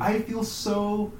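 put-on slow, high-pitched little-kid lament; mock crying child. Little David crying when he's in pain, hammed-up sad. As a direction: draw it out as a hurting child. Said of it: "when he, he cries".

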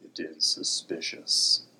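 slow rumbling rock creature; throaty grinding voice. Acted. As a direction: drag it out, low and grinding. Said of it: "A low rumble came from Igneous's throat".

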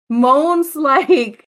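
saying moans through a laugh. Real, not acted.

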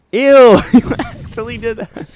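high squeal, laughing in disgust. Real, not acted. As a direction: shriek "ew" then laugh it out. Reacting to friend eating candy.

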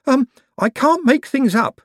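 nervous little man confessing; high fretful Owlswick. A narrator's reading. Owlswick anxious admission.

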